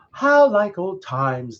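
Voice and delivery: drawn-out pleasant chatter; pleased villagers. A narrator's reading.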